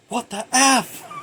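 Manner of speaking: high, loud, stretched-out exclamation; imitating a man jolted awake; mock startled outburst. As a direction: blurt it in hammed-up shock. Acting startled man's shocked outburst.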